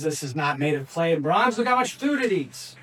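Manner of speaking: mock outrage, rising sharply at the end; indignant protest. King defends his god to Daniel, indignant protest.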